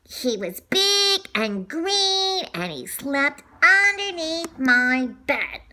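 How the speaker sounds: plain reading voice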